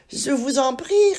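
«Je vous en prie» dit nettement plus haut et plus fort; un serpent mielleux qui monte dans l'aigu; voix aiguë de serpent. Acted. Le serpent répond poliment. (serpent poli, voix haute)

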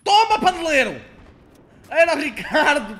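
loud, high triumphant yell; gloating shout. Real, not acted. Gamer yelling over a kill, loud and triumphant. Kills, then realises teammate.

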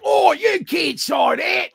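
high, drawn-out mock Aussie shout; screaming Australian heckler. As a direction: bellow slowly like a drunk Australian. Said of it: "Oh, he was screaming it".

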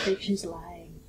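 conspiratorial whispered aside; whispering. Real, not acted. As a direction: whisper it behind her back. Aside about another player.

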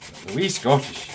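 a quick burst of Scottish brogue; Scottish accent. Shown as a demonstration. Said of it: "been able to get is, uh, Cornish".

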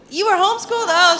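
sudden high, loud disbelief; startled squeak of a question; surprised. Real, not acted. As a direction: jump up in mock shock. Mock shock at homeschooled listener.

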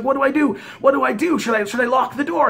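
high, panicky questions; freaking-out old lady. Retold or imitated. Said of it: "she's freaking out".

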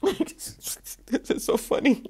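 laughing it out under her breath; giggling through the words; breathy laugh. Real, not acted. Tarot reader cracking up at the cards.

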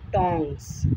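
टोंग्स with the S sounded at the end; final S sounded. Shown as a demonstration. Teacher teaching the word for tongs, final S sounded. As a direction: say टोंग्स ending on a clear 's'. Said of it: "एस के बगैर सही नहीं है".

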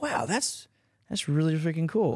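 high, wide-eyed starstruck gush; starstruck wow. Retold or imitated. Dancer told by Oscar winners they love him, high starstruck gush. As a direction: gasp it out like a fan meeting a hero. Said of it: "and I'm like".

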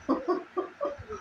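breathless squeal of laughter; helpless high-pitched cackle; high wheezing laugh. Real, not acted. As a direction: lose it completely at the video. Laughing at the clip.

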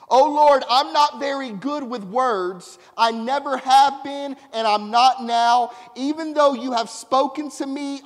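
loud, rising scripture read with preaching force; fervent scripture reading. Acted. Preacher reading Exodus aloud to the congregation, fervent and loud. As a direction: proclaim the verses like a preacher.

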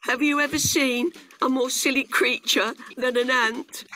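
high grasshopper shout; shouted taunt. A narrator's reading. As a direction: jeer after the departing ant. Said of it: "shouted the grasshopper".

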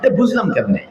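ordinary delivery

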